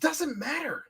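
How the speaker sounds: high strained whisper shout; whisper yelling. Real, not acted. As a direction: shout it under your breath. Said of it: "He's whisper yelling".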